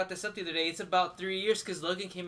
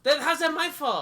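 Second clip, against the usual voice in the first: indignant; 10 semitones higher, 8 dB louder than usual. Real, not acted. Arguing about the forgotten beer.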